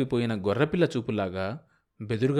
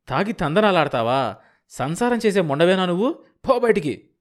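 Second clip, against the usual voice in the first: builds from a raised voice to a high, loud yell; furious shouting; 4 semitones higher, 5 dB louder than usual. Acted. Drunk husband dragging his wife, shouting her out of the house. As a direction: rage at her, louder with every accusation. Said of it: "అరిచాడు".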